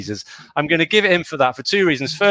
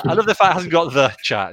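ordinary delivery

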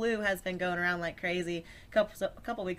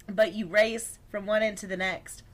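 normal talking voice

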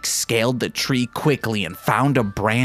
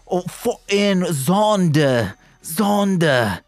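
drawled Australian name Zonda, slow and higher; Australian accent; 7 semitones higher, slower than usual. Shown as a demonstration. Podcaster landing an Australian accent on a name. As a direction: lean into an Australian vowel on Zonda. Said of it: "Yeah, there we go".

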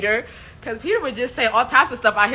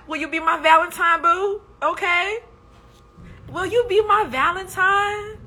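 Jesus asking sweetly; 10 semitones higher, 4 dB louder than usual. Acted. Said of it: "Jesus like".

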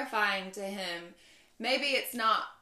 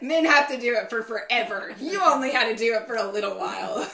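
mock divine praise; 3 semitones higher, 10 dB louder than usual. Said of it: "He's gonna be like".

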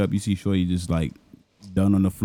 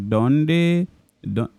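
higher caricature Indian boss saying the days; mock Indian accent; 5 semitones higher than usual. Boss telling the clerk the days, mock Indian accent. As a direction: blur Sunday and Monday alike in an Indian accent. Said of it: "it just sounded the same".

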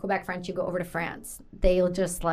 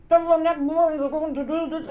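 higher-pitched garbled imitation of the chef; muffled rough Acadian talker; 6 semitones higher than usual. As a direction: run the words together unintelligibly, mouth barely open. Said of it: "he'd be like".

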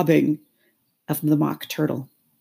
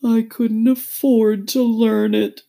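high, slow, loud lament; drawn-out regretful turtle voice; sighing Mock Turtle; 7 semitones higher, 8 dB louder, slower than usual. A narrator's reading. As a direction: sigh it out woefully. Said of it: "with a sigh".

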